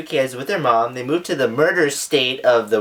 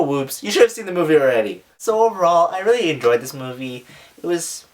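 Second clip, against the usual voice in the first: ordinary delivery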